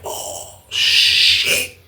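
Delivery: voiceless, drawn-out shock; hushed reaction to shocking news; stunned whisper. Retold or imitated. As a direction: let it out as a breathy whisper. Retelling his shock at the news.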